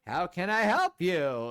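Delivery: sarcastic droid, loud, high and slow; John Mulaney. Acted. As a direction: greet customers as a cartoonish comedian droid. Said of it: "I made John Mulaney sound".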